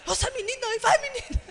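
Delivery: high, loud cheer breaking into a laugh; mock the keen grandpa, laughing; excited cheering. Grandfather urging the girl on, high and laughing.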